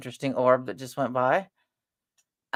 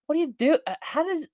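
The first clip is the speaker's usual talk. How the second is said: incredulous high question; 12 semitones higher than usual. Retold or imitated. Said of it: "I'm like".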